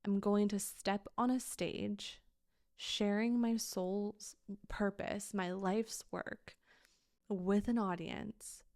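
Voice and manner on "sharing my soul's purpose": ordinary delivery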